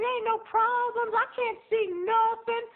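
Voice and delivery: squeaky high voice, slow on the last line; high mock Tammy voice. Teller imitating his friend's carefree denial, high and squeaky. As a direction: mimic a woman's breezy denial in a high voice.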